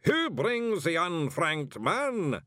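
high, slow ritual challenge; booming ceremonial voice. A narrator's reading. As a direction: boom it out slowly across a dark hall. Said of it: "a voice boomed".

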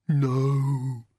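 drawn-out monster groan; long, woeful creature protest; dismayed bogeyman. Acted. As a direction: moan it as a defeated bogeyman. Bogeyman dreads the blanket.

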